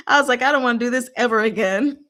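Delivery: ordinary talk, nothing marked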